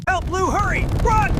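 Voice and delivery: high, loud warning cry; urgent shout. Acted. Friend yelling at the animals to run. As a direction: yell it to flee the fire. Fleeing the fire.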